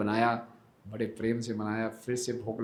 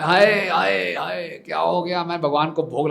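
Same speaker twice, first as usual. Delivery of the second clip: much higher, louder cries of hai hai; dismayed wailing cry; 7 semitones higher, 10 dB louder than usual. Acted. Brahmin sees the child eating his offering, dismayed wailing cry. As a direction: wail in horror at the spoiled offering.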